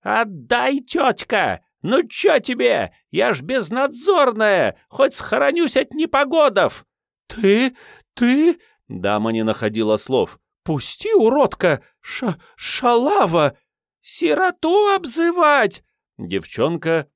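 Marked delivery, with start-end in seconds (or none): 0.0-6.9 high, shrill-free whiny urchin voice; street urchin girl. Acted. Street girl grabbing the umbrella. 7.3-8.6 high, indignant gasping lady; outraged lady. Acted. Lady outraged, lost for words. 10.6-15.8 high, slow insulting urchin squeal; street urchin girl. Acted.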